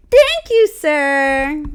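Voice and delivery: very high sugary thank you; fake polite. Thanking the salesman, sugary and mocking. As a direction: chirp it with mock sweetness. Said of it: "I'm like".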